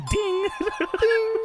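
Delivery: high-pitched imitated bell; mimicked buzzer-bell ding; lie detector ding. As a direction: sing out a high little ding. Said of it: "a lie detector test go".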